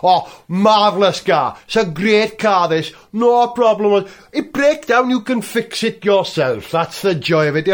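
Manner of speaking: put-on loud, cheery London cabbie accent; London cab driver accent. Cardiff taxi driver praising his Lada, London cabbie accent. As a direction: sell it like a chirpy London cabbie. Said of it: "a standard London cab driver accent".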